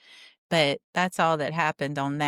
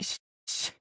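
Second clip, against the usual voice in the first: mock shushing; 7 dB quieter than usual. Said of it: "And they're like".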